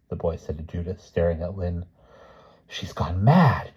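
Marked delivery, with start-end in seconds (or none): 2.7-3.7 slow, tense hiss; slow, venomous remark. A narrator's reading. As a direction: hiss it with drawn-out disgust. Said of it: "Judith hissed".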